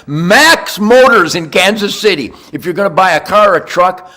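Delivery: loud, punchy sponsor pitch; ad read. Acted. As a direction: sell the dealership like a radio spot. Reads a sponsor ad for Max Motors.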